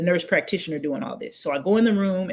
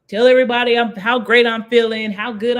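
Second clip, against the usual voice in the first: excited; 4 semitones higher, 6 dB louder than usual. Retold or imitated. Excited Facebook post.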